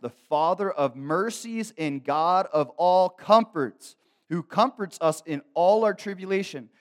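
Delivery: loud, high, drawn-out proclamation; ringing pulpit delivery, stretched and loud; full-force scripture reading. Acted. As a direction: declaim the verse with preacher's force.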